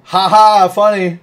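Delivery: high, loud, laughing sarcastic "ha ha"; laughing mock of Danny's text; sarcastic DeVito. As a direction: fire off a mocking text reply, loud and high. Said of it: "Danny that's just all like".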